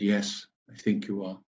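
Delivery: low, hushed reply; retold hard truth, dropped almost under the voice; quiet, grave admission. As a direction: say it softly and low, breaking terrible news gently. Breaking death news to dying girl.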